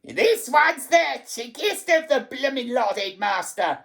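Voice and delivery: dreadful high harridan voice; piercing, spiteful telltale; high-pitched screeching. A narrator's reading. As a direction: screech it to egg on the headmaster. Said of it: "high-pitched voice behind me screeching".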